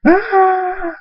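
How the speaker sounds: very high yelp of fright; scared scream; terrified cry. Acted. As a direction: shriek while running away. Fleeing thug cries out.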